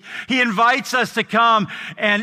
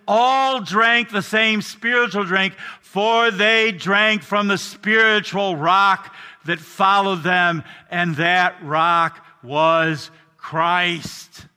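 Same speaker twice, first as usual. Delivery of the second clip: slowing scripture; much slower than usual. Acted. Emphatic scripture quote, slowing down.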